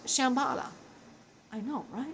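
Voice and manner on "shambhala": normal talking voice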